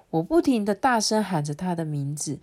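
plain reading voice

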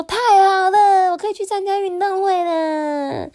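very high, stretched-out cheer; overjoyed rice cooker. A narrator's reading. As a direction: squeal with joy in a cartoon voice. Rice cooker rejoices at being allowed.

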